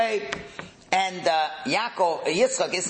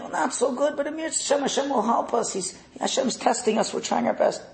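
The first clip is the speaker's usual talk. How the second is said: put-on religious child; 9 semitones higher than usual. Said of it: "One is very religious".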